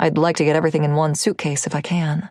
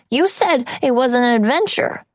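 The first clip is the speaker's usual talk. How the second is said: put-on child voice; 7 semitones higher than usual. Narrator voices the son.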